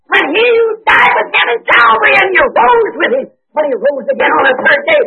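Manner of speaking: high shouted preaching cry; preaching at full force. Real, not acted. Preacher shouting resurrection to the church. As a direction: lift the crowd with it.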